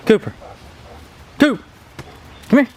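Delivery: normal talking voice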